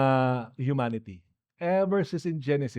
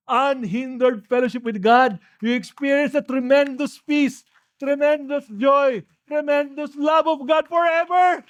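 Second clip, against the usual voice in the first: rising preacher fervour; 6 semitones higher than usual. Acted. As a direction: lift the crowd as you preach. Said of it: "builds to climax". (lalong tumataas at lumalakas ang boses ng pangangaral)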